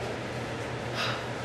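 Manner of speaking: soft, breath-only sigh of dismay. Retold or imitated. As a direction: let out a quiet sigh at bad news. Retold dismay at the bad news.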